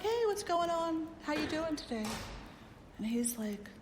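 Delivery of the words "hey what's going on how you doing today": cheery greeting. Retold or imitated.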